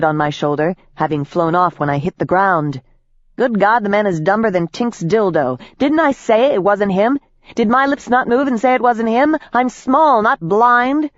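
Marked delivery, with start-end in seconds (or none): 3.4-11.1 indignant rant. Acted. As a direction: scold him, rising exasperation. Jenks rants indignantly at Trent.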